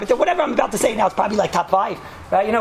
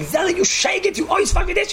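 mock screaming; 11 semitones higher than usual. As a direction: scream it like the outraged wife, hammed-up. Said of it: "so she starts screaming".

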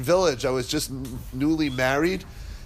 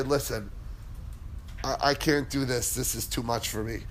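quiet giving up; 5 semitones lower, 9 dB quieter than usual. Retold or imitated. Retold giving up at the pigeon.

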